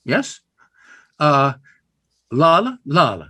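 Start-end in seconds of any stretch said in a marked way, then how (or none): 2.3-3.3 la la sung with the beat on the second la; chanted la la beats; da-dum rhythm. Shown as a demonstration. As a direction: tap out the meter on la. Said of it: "the concept there of".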